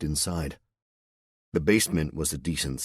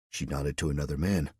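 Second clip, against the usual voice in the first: usual speaking voice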